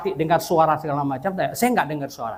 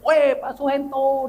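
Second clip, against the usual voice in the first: high, slow, stretched-out greeting call; answering hail; 8 semitones higher, slower than usual. Retold or imitated. Pak Yosep greeting the visitor back, high stretched hail. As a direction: hail a neighbour back, imitating him. Neighbour hails him back.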